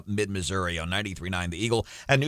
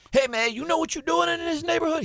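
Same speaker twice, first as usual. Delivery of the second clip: imitated street dialect, pitched way up; faster than usual. Host mimicking the comedian for listeners, high mock street dialect. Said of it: "He almost got into that street dialect".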